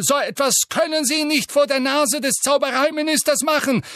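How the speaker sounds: high, loud, nervous bluster; flustered minister. A narrator's reading. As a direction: bluster to regain control. Fudge voiced in audiobook.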